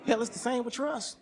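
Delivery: ordinary talk, nothing marked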